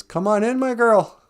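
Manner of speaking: high, loud mock grandma voice; wolf's put-on granny falsetto; wolf posing as grandma. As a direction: call out sweetly from inside, high-pitched. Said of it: "she hears a voice on the inside".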